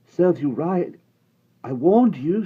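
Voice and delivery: high, loud told-you-so; scolding boy. A narrator's reading. Omri scolding Patrick who got shot. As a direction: snap back at him.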